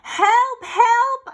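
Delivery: high, loud cry for help; calling for help. Acted. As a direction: shout for help in panic.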